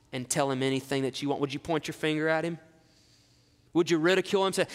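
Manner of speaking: ordinary talk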